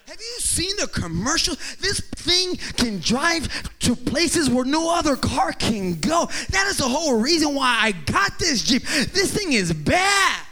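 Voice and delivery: high-pitched, hammed-up car enthusiast's rave; all passionate. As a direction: re-enact a proud husband preaching his Jeep. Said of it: "I said it just like that, too, like, all passionate".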